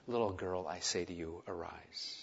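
very soft, low and gentle; hushed, tender command. Acted. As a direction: speak softly as if to a sleeping child. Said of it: "So tender".

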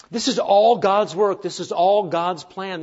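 slow and weighty on "all"; stress on all. Real, not acted. Preacher stressing God does all the work, slow. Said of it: "emphasis on all".

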